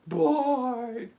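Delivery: high, loud farewell call; cheery sign-off. Real, not acted. Streamer saying goodbye to viewers, high loud call.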